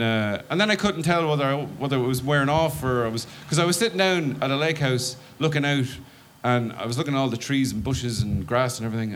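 ordinary talk

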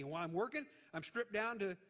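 ordinary talk

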